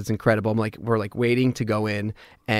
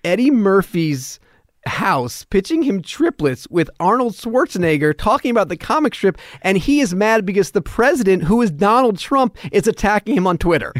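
overwhelmed disbelief; 7 semitones higher than usual. Retold or imitated. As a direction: spell out each absurd fact in amazement. Acts out amazement at his life.

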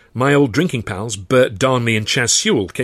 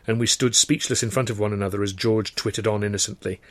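usual speaking voice

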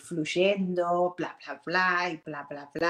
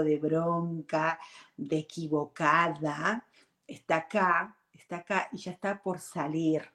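ordinary talk

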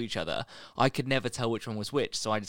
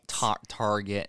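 slow, badly faked American accent; stretched mock American R; much slower than usual. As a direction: stumble into a terrible American accent. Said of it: "we're doing terrible accents".

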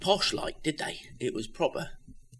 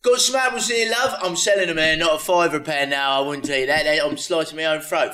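loud, higher cockney market patter; market trader voice; 3 semitones higher, 4 dB louder than usual. Pretend. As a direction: hawk fruit like a London barrow boy. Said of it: "do it as if you were Del Boy".

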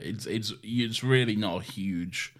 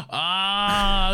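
sudden high gasp of recognition; surprised gasp; 10 semitones higher, 4 dB louder than usual. Real, not acted. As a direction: gasp as it all comes back. Recognition of a forgotten memory.

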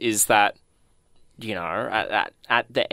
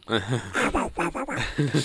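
squawky imitation of Donald Duck; garbled cartoon-duck quacks; Donald Duck quacking; 4 dB quieter than usual. Showing off the Disney character, imitated duck squawks. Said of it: "That was actually pretty good".

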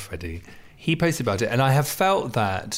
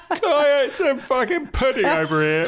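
much higher, louder mock drunk shout; 6 dB louder than usual. Friend mimicking her drunk at the table, shouting. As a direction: bellow it like a tipsy diner demanding pudding. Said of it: "I've done that voice for you".

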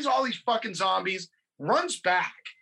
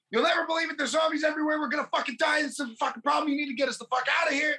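high-pitched frantic rush of words; hammed-up frantic babble; imitated panic; 7 semitones higher, much faster than usual. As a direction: plead in a mock panic, pitch held high. Retold panicked plea to Guitar Wolf.

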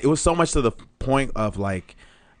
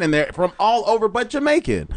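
normal talking voice

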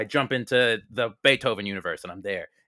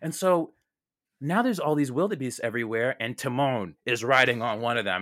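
usual speaking voice

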